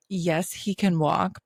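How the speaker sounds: normal talking voice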